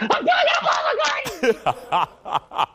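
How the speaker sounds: very high, slow, squealing with laughter; inner screaming fan. Retold or imitated. Inner fangirl meeting [name], high squealing laugh. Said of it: "On the inside, I'm like".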